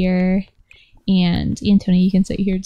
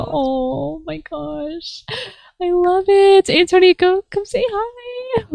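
pitched way up, cooing over a puppy; high cooing; 9 semitones higher, slower than usual. Real, not acted. Gamer cooing high at her new pet dog. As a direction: coo at the new dog in delight.

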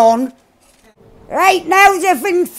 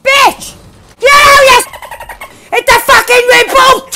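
high, loud gamer outburst; heated yelling; 5 semitones higher, 3 dB louder than usual. Real, not acted. Player reacting to a reboot, yelling. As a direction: yell it at the screen.

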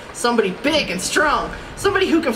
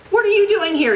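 high, indignant storybook yell; 10 semitones higher, 4 dB louder than usual. A narrator's reading. Donald discovers his nephews at the party, yelling. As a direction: raise the pitch in mild outrage. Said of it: "yelled Donald".

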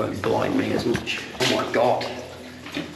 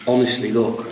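ordinary delivery

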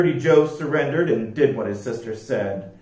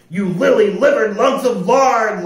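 loud, higher scolding of his crew; angry lash-out; 6 semitones higher, 5 dB louder than usual. A narrator's reading. Dirty Joe lashing out at his frightened crew, angry. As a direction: tear into the cowards. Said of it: "lashed out Dirty Joe".

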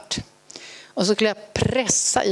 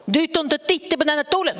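high mock toddler yell; high-pitched indignant little-kid shriek; child screaming; 8 semitones higher than usual. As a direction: imitate a small child yelling an order. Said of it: "skriker till mig".

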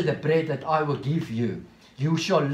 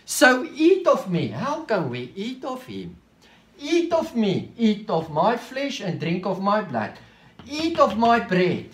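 fervent preaching; 4 semitones higher than usual. Acted. Preacher urging his congregation to eat of the word. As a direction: press the verse on the listeners.

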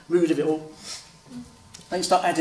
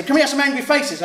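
eager teacher's call; 8 semitones higher than usual. Retold or imitated. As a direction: coax the class to pull angry faces. Teacher urging the class.

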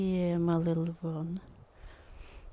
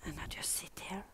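soft whispered aside; 14 dB quieter, faster than usual. Real, not acted. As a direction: hush it to yourself. Sitting quietly.

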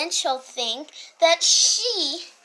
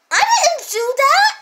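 higher and louder protest; indignant denial; 11 semitones higher, 8 dB louder than usual. Acted. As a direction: protest your innocence hard. Sibling denies, pleading.

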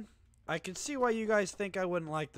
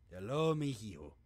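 normal talking voice

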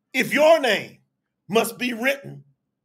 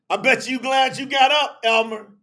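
normal talking voice

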